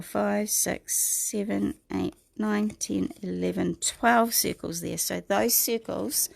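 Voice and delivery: ordinary talk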